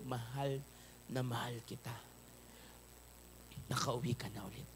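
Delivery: soft, low, tender father's voice. Acted. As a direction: whisper love to a child come home. Father embracing returning son. (malambing at mahinang boses ng ama)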